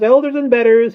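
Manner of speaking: very high, very loud screech; conceited bird's piercing shriek; screaming. A narrator's reading. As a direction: scream it at the peak. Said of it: "he screamed".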